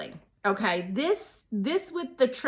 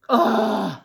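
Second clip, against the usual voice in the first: loud sigh of delight after sniffing; blissful sigh; 7 dB louder than usual. Real, not acted. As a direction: let out a big savoring exhale. Sniffing her favorite oil.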